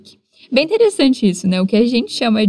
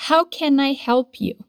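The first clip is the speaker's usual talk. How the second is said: slow model phrase; 4 semitones higher, slower than usual. Shown as a demonstration. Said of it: "Repete aí comigo".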